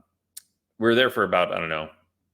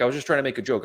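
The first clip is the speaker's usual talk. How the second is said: flustered excuse; 6 semitones higher, much faster than usual. Retold or imitated. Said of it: "stammering over himself".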